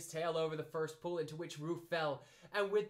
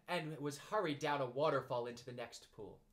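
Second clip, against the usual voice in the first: plain reading voice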